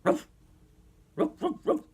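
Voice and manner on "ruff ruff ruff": high squeaky puppy yaps; playful dog bark. Pretend. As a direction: yap like a tiny toy dog. Toy dog barking for child.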